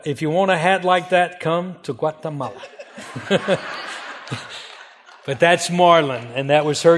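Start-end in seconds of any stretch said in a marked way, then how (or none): 2.7-4.6 soft laugh. Real, not acted. Joke about graduation hats.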